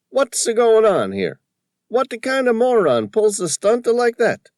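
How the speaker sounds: high, loud, excited Tony voice with a put-on accent; an indignant shopkeeper squawking about the photo; riled old Tony. A narrator's reading. Shopkeeper upset at the vandal, loud high indignant voice.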